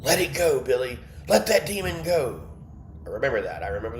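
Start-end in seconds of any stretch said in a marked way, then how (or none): 0.0-2.4 pastor casting out. Retold or imitated. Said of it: "the pastor said".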